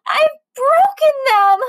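high, loud wail of dismay; distressed cry. Acted. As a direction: cry it out in horror. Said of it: "cried Dora".

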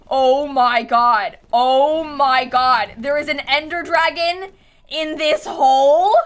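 slow, loud shock; stunned disbelief. Real, not acted. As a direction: draw it out, aghast at what you found. Finds dragon in the hole.

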